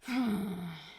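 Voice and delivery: slow breath blown out; long exhale. A narrator's reading. Crowley exhales, tired of waiting in the cold. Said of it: "blew out a long breath".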